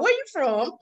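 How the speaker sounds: a second laughing Southern take of "Where you from?", rising at the end; Southern drawl question. Retold or imitated. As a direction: tease in a Southern accent, laughing. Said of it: "my country accent is thicker here".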